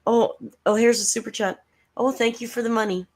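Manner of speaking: high, gushing mock thank-you. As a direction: fake delight over the money. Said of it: "She's like".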